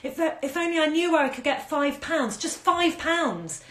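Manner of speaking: whimpering. A narrator's reading. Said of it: "she whimpered".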